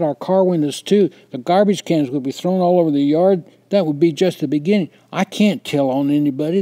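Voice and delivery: loud, high pleading, voice cracking with tears; desperate tearful shouting. A narrator's reading. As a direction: keep yelling your fear through the crying. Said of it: "I shouted through my flow of tears".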